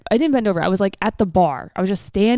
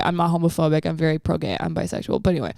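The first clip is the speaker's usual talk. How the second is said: usual speaking voice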